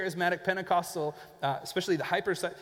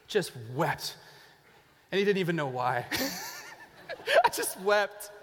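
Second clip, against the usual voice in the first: laughing imitation; 4 semitones higher than usual. Imitating Piper weeping, laughing.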